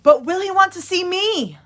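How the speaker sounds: high, loud anxious call. A narrator's reading. Punchinello calls after departing Lucia, loud and high. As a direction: call after her, worried. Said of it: "Punchinello cried out".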